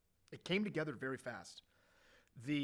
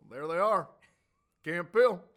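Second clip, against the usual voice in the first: hearty greeting; 3 semitones higher, 13 dB louder than usual. Retold or imitated. President greets them by name.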